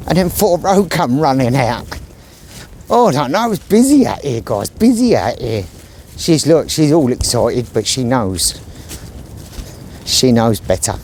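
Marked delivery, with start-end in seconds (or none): none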